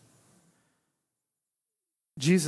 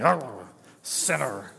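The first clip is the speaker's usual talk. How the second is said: low, slow jowly growl of a word; a hammed-up jowly politician sneer; Nixon jowls voice; 5 semitones lower, much slower than usual. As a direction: shake your jaws and drag it out low. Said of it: "that, like, Nixon thing".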